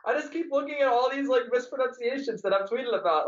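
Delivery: ordinary delivery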